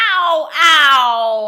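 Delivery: high, loud, drawn-out yelps; crying ow. A narrator's reading. Everyone rolls downhill, crying out in pain. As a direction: howl ow while tumbling. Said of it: "cried everyone".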